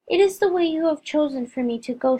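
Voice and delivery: ordinary delivery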